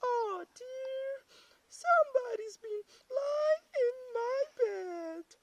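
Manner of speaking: very high, loud wailing falsetto; middle-sized bear cry. A narrator's reading. Middle-sized bear finds her bed rumpled, high wailing cry. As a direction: wail it high as mother bear. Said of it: "cried in her middle-sized voice".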